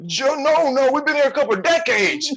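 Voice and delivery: put-on a tired old man complaining about waiting; lightly voiced old man, half laughing; old man again. Old man answering the question.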